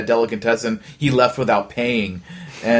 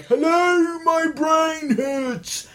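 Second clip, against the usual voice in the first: Monty Python Gumby; much slower than usual. Retold or imitated. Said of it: "the Monty Python guys who are all like".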